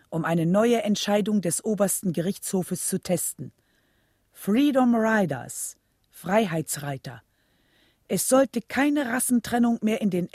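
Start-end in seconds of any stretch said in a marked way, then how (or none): none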